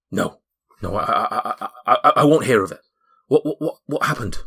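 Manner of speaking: stammering, rising in pitch; shocked denial. Acted. Dwarf hears the lady is dead, in stammering shock. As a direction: refuse the news, tripping over repeated words. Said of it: "the blood drains from his face".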